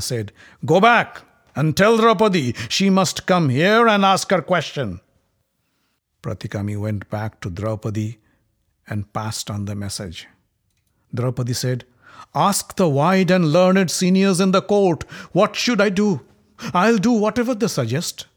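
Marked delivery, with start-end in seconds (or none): 0.7-5.0 raised, louder royal command; commanding prince. Acted. Prince ordering his messenger, commanding and louder. 12.3-18.3 higher, louder challenge; defiant queen. Acted. Queen sending word back to the court, higher and challenging. Draupadi answers the summons.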